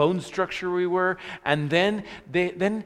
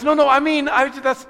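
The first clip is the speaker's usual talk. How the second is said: a much higher, louder backpedaling nurse; flustered nurse; 12 semitones higher, 12 dB louder than usual. Retold or imitated. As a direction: scramble to take it back. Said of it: "And she goes".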